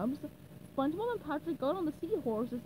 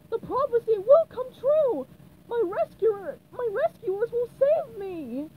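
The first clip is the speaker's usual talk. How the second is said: high, loud defiant captive princess; 9 semitones higher, 9 dB louder than usual. Acted. Princess Pearl defying the evil wizard, high and loud. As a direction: throw your faith in their faces.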